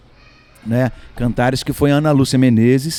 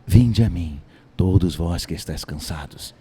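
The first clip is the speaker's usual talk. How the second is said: lightly lowered, solemn Jesus; 7 semitones lower, 4 dB quieter than usual. Shown as a demonstration. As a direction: speak gently as Jesus. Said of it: "eu fazia a voz de Jesus".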